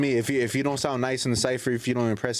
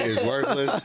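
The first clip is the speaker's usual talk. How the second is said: laughing; 10 semitones higher, slower than usual. Real, not acted. Mocking cursive amid group laughter.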